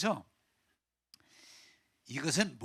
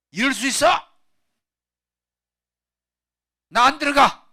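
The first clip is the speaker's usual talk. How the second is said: high, full-volume refusal; angry shouting; 11 semitones higher, 14 dB louder than usual. Acted. Older brother refusing to join the feast, angry shout. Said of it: "이런 때 소리 질러보지 언제 질르겠어요".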